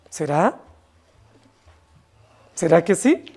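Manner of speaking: low, questioning murmur with a rising end; mock doubt. Preacher voices believers doubting the Bible's warning, skeptical.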